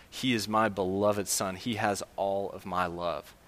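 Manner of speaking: soft, slow loving whisper of a father; tender. Acted. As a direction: let it sink in quietly, like a father's blessing.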